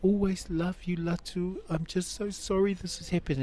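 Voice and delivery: high, tearful retold confession; imitated crying. Ex-wife telling him she always loved him, imitated weeping. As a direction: act out a weepy declaration of love, pitched well up. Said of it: "we're crying to each other".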